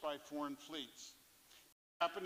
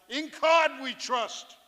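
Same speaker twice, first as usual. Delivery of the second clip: slow, loud, higher shout of the pun; yell; 8 semitones higher, 16 dB louder, slower than usual. Retold or imitated. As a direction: bellow it out over the music. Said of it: "our skipper would yell".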